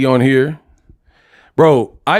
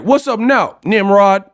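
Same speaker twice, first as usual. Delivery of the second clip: high, challenging movie-gangster call; mock L.A. gangster; 5 semitones higher than usual. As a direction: parody a censored gangster picking a fight. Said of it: "they'll be like".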